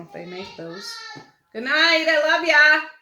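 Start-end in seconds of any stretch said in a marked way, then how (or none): 1.5-2.9 loud, high singsong holler to the family; real shouted bedtime farewell; calling out goodnight. Real, not acted. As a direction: call it through the house.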